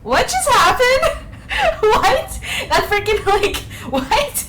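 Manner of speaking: high, loud laughing disbelief; astonished outburst. Real, not acted. Streamer stunned by what just happened, astonished. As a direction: burst out baffled, voice shooting up.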